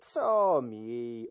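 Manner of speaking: grumbled brush-off; dismissive mutter. Acted. Count brushing off the chamberlain, dismissive mutter. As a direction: shrug him off. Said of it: "odmruknął hrabia".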